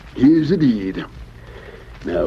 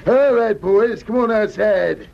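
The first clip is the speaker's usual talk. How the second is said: gleeful triumph; 10 semitones higher than usual. Acted. Ab triumphant after the swindle.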